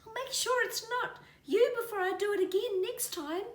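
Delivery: high, slightly loud creature voice; mother possum reassures the scared kiwi. A narrator's reading. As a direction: promise it kindly as a mother. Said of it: "said the mother possum".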